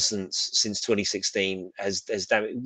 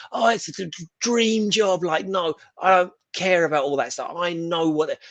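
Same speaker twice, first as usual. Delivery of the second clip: higher, stammering with mock excitement over a dream job; mock starstruck writer; 8 semitones higher than usual. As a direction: parody a giddy Superman writer. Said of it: "Or Superman".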